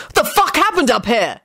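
high, alarmed cry; frightened shout. A narrator's reading. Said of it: "Jamie shouted in a frightened tone".

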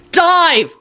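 high urgent warning cry; wild yell. A narrator's reading. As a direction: yell it as an alarm. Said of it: "Lupin yelled wildly".

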